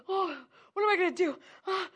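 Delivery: high, loud startled panic; mock panic, high and loud; panicked gasp. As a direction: blurt it out like sudden fear has grabbed you. Acted panic when crisis hits.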